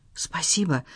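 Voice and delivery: едва слышно, полушёпотом; тихо поблагодари, почти шёпотом; тихий шёпот. A narrator's reading. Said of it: "шепнула Пиа Тане". (шёпотом)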